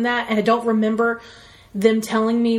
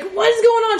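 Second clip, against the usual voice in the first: much higher, loud alarmed cry; shocked panic; 12 semitones higher, 4 dB louder than usual. Retold or imitated. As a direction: yelp it in sudden alarm. Nurse shoves swab up nose.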